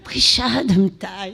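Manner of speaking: demonstrated French accent; short French-accented phrase. Shown as a demonstration. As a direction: say it as a French speaker would. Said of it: "I will say with a French accent".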